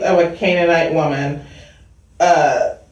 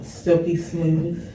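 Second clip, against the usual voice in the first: slow, softer radio-host croon; smooth radio voice; 4 semitones lower, 7 dB quieter, slower than usual. Pretend. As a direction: stretch the name like a late-night DJ. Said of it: "I feel like I have to say it like that".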